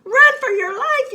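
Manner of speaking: high, loud yell to flee. Acted. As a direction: shout it in panic. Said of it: "yelled Rabbit".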